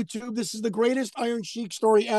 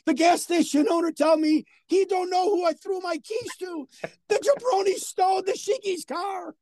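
Iron Sheik impression; 8 semitones higher than usual. Iron Sheik telling Johnny his car was stolen, high outraged shout. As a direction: howl the punchline like the outraged Sheik. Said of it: "He said".

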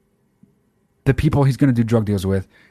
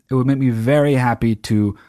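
usual speaking voice

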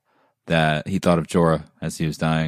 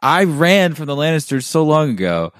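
higher, louder, baffled outburst; incredulous Hound; 8 semitones higher, 3 dB louder than usual. Retold or imitated. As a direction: play the Hound as fed up. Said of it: "He was just like".

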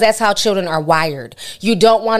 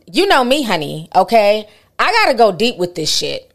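ordinary delivery

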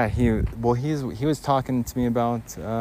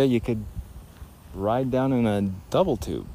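ordinary talk, nothing marked

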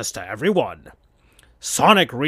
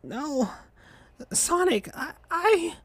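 much higher, softer, fading plea; slow dying voice; 14 semitones higher, 7 dB quieter, slower than usual. Acted. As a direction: plead weakly like she's fading. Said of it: "in a still a slow, dying way".

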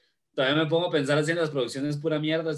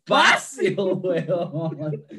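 laughing; 4 semitones higher, 6 dB louder, much slower than usual. Acted. Echo while laughing.

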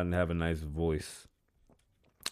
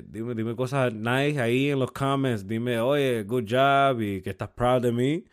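loud, high hype plug in Spanish; Spanish promo shout; 6 semitones higher, 7 dB louder, slower than usual. Acted. Host hyping merch to Spanish-speaking fans, loud and high.

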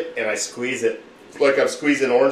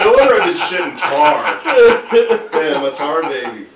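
laughing; 3 semitones higher, 8 dB louder, slower than usual. Real, not acted. Laughing joke.

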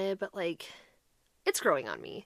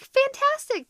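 mock cheerful; 5 dB louder, slower than usual. [name] agreeing to the bad trade.

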